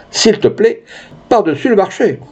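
very high, mincing add-on; high-pitched, insolent flourish; cheeky candidate. Acted. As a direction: tack it on with mock politeness. Candidate mocking France with cheeky demands.

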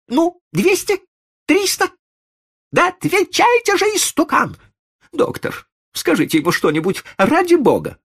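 very high, imperious, harried voice; exasperated rich lady. A narrator's reading. Lady demanding migraine pills and an answer, exasperated. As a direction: order and haggle in a high lady's voice.

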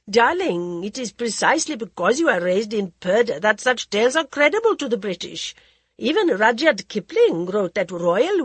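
languid society lady, teasing; a drawling, worldly hostess; Lady Modi voice. Acted.

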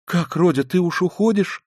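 high, loud, alarmed mother; frightened pleading. A narrator's reading. Mother to departing Rodya, frightened pleading. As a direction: plead anxiously, voice lifted high. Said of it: "Даже с испугом спросила Пульхерия Александровна".

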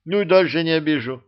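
plain reading voice

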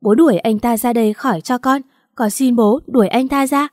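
ordinary delivery, nothing marked

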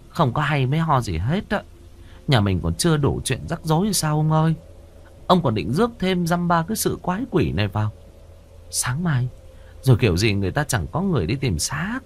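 high, nagging woman's voice; exasperated wife voice. Acted. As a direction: talk him out of it, fed up.

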